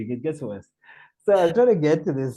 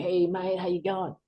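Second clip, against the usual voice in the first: higher-pitched Australian greeting; Australian accent; 6 semitones higher than usual. Retold or imitated. Guy greeting a new arrival, Australian accent. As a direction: imitate a breezy Australian bloke. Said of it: "I could really do the Australian thing".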